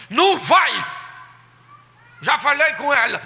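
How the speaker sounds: loud high stern no; stern refusal. Retold or imitated. Strict father refusing his wife, loud. Uncle forbids again.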